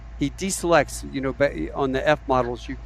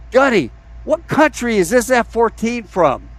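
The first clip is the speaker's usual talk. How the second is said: imitating an alarmed kid, higher and louder; urgent alarmed question; 9 semitones higher, 7 dB louder than usual. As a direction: call out the question in mock alarm. Kid urgently questions gunny over aircraft.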